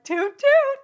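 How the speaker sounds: very high, loud mock toot; toddler toot. Comic mocking adults in open relationships, shrieky toddler toot. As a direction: hoot it like a little kid's toy train. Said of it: "That's all I hear is toot, toot".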